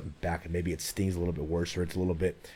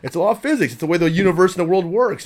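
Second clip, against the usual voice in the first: raised voice, hammering the point home; loud insistence; 9 semitones higher, 14 dB louder than usual. Real, not acted. Guest insisting to the hosts that it's physics, loudly emphatic. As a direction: declare it louder and louder like a settled law.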